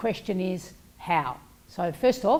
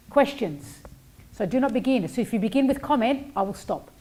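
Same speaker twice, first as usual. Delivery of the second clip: ordinary delivery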